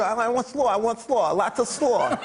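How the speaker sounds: high, insistent boxer impression; Mike Tyson. Boxer repeating his order, high Mike Tyson impression. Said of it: "Mike Tyson going through the drive-thru".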